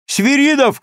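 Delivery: loud, high call across the room; shout. A narrator's reading. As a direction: shout the name out to a soldier. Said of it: "крикнул лейтенант".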